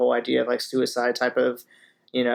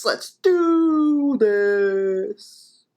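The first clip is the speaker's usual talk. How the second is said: high, very slow drawn-out "Let's do this"; hammed-up hype call; 9 semitones higher, 4 dB louder, much slower than usual. Vlogger hyping his viewers before the track, drawn-out hype call. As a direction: stretch "Let's do this" out like an announcer revving up a crowd.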